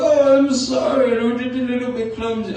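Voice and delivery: high-pitched apologetic cartoon bear; mumbly bad bear impression, like the Swedish Chef; Pooh Bear. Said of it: "that Pooh Bear impression".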